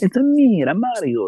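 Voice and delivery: very high, loud cartoon Italian accent; Mario's Italian accent. Showing friend how it should sound, high Italian accent. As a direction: squeal it like an excited Italian game mascot. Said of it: "that's how it's supposed to be".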